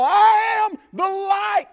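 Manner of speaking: slow, loud, high proclaiming shout; shouted proclamation. Acted. Preacher declaring Jesus' words to the church, slow loud shout. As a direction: thunder it out, slowly.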